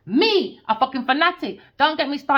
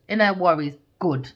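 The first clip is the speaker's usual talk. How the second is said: ordinary delivery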